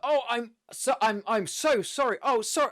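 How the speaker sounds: high, hammed-up gushing apology; over-the-top apology. Clumsy adventurer to the orc he tripped, over the top. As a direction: make a loud, theatrical fuss of saying sorry. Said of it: "with a very over the top voice".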